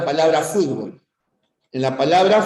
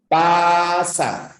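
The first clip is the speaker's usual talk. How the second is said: "Pasa" split as a held "paa", then "sa"; syllable boundary shown. Shown as a demonstration. Teacher showing vowel followed by consonant, split syllables. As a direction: stretch the first a of "Pasa", then drop to "sa". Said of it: "si una sílaba termina en vocal".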